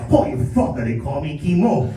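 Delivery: local Hawaiian Pidgin guy; Hawaiian Pidgin accent. Pretend. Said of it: "Bro, I speak Pidgin too".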